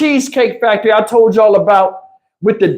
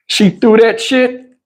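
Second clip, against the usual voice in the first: ordinary talk, nothing marked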